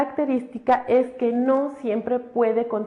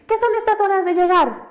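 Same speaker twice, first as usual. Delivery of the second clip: imitating a scolding mother, much higher and louder; scolding mom; 8 semitones higher, 4 dB louder than usual. Teacher imitating a mother scolding her late child. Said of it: "la mamá nos regañan y nos dicen".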